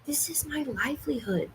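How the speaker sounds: hushed and intense; whisper-scold so viewers won't hear. Real, not acted.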